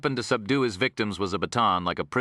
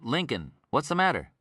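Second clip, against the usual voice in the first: higher-pitched, worried question; concerned; 6 semitones higher than usual. A narrator's reading. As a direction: ask your boss with concern.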